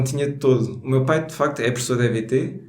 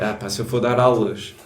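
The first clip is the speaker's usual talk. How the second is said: normal talking voice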